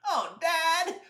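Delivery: put-on high-pitched child's whine; whiny protest. Boy protests dad's sugar ban, whining.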